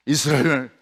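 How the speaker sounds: plain reading voice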